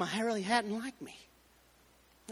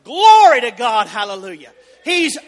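full-force preaching; 4 semitones higher, 10 dB louder than usual. Acted. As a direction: thunder it out from the pulpit.

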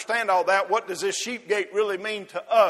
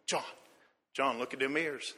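soft, hushed and fond, admiring the lamb; tender fatherly voice; 5 semitones lower, 7 dB quieter than usual. Retold or imitated. Zacharias quietly showing his son John the spotless lamb, softly tender. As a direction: murmur to your son over a perfect lamb. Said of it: "I can see Zacharias saying".

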